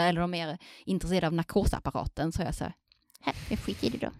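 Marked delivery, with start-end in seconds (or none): 3.2-4.1 soft, near-voiceless dismissal; retold giving up. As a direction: drop to a small, airy mock-sulky voice. Acts giving up on uninterested students.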